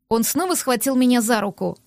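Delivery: plain reading voice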